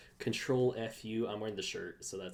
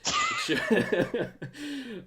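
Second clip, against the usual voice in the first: high, loud burst of laughter; 10 dB louder than usual. Real, not acted. Cracking up at own teasing reply, loud high laugh.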